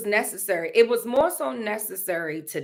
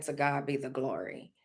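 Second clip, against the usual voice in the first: soft unvoiced whisper of praise; whispered prayer; 7 semitones lower, 11 dB quieter than usual. Remembering praying to God alone, whispered. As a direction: breathe it like a private prayer. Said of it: "I found myself saying".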